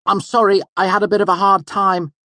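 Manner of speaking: high, loud nervous student; nervous boy. A narrator's reading. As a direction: blurt the name anxiously. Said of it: "the boy said nervously".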